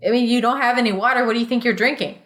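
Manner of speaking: normal talking voice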